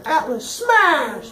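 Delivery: high, drawn-out battle cry; mock yelling. Atlas running around smashing things, mock yell. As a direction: bellow it like a dumb brute on a rampage. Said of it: "just running around yelling".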